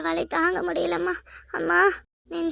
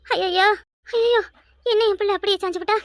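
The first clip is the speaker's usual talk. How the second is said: louder, higher cry of alarm; alarmed wail; 3 semitones higher, 5 dB louder than usual. Acted. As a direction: cry out as she slumps. Mother sees daughter collapse. (அதிர்ச்சியான அலறல்)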